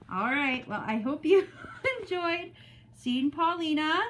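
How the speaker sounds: ordinary delivery, nothing marked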